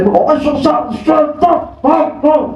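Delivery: put-on high garbled old man, like German; mock scolding elder, words unclear; grumpy old man gibberish. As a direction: mumble the words so kids can't follow. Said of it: "Oh, dia cakap Jerman".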